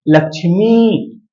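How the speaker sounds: लक्ष्मी said slowly, final ई stretched long; long ई drawn out. Shown as a demonstration. Teacher showing students the long ई, slow and stretched. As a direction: drag out the ई in लक्ष्मी. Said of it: "क्योंकि यहाँ पर बड़ी ई है ना".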